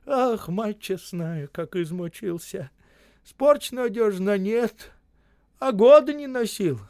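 high, slow lament; plaintive complaint. A narrator's reading. Осип lying on the ground, complaining plaintively. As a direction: whine it out in a drawn-out high voice. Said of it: "жалуется тихонько".

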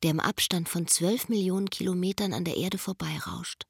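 hushed, breathy-free whisper of wonder; a secret told under the stars. Acted. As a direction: whisper it to the night sky. Inner voice over night sky.

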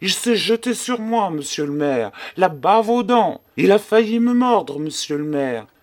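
usual speaking voice

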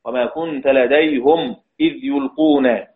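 measured recital, لديهم as "ladayhum"; Hamza's recitation. Shown as a demonstration. Teacher quoting verse examples to students, لديهم as "ladayhum". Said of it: "يقرأ حمزة".

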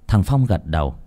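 usual speaking voice

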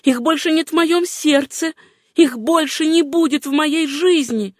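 high, emphatic vow; heartfelt, high-pitched declaration; fervent relief. A narrator's reading. Susanna rejoicing rags are gone.

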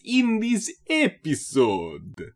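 slow, high hammy Dracula drawl; fun vampire voice. Acted. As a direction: ham up a silly vampire host. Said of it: "A little bit of a fun little vampire voice".

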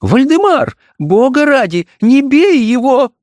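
high, slow, overdone pleading cry; theatrical fright. A narrator's reading. Wife begs husband not to beat son, theatrically frightened. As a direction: play the fear for show. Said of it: "кричала она с театральным испугом".

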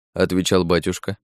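ordinary delivery

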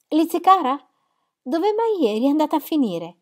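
high, loud call across the room. Acted. Jane calls to Elizabeth arriving late, high and loud.

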